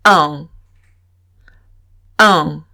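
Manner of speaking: un as the nasal French one; nasal un, held briefly. Shown as a demonstration. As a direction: model the nasal un. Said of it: "El uno en francés es".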